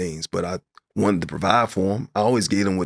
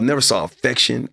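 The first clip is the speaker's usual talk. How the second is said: ordinary talk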